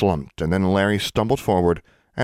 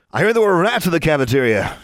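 high, loud taunting crow; gleeful crowing; 10 semitones higher, 4 dB louder than usual. A narrator's reading. Boy mocks Alexandra before the others, crowing loudly. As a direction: crow it out for everyone to hear. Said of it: "he crowed".

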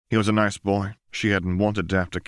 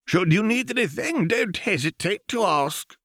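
put-on higher, frail voice of a tottering old man; old archivist; 13 semitones higher than usual. A narrator's reading. Old archivist greets Lux, higher and frail. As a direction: offer help like a doddering old keeper.

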